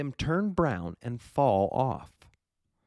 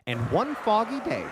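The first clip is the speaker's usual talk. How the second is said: plain reading voice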